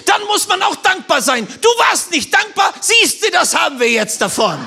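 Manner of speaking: high-pitched mock reproach; retold scolding.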